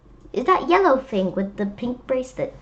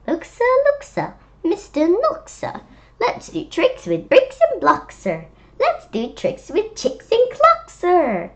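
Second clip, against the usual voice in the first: high, louder, coaxing fox; eager fox voice; 8 semitones higher, 4 dB louder than usual. Acted. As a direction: play the sly fox tempting Knox. Fox speaking to Mister Knox.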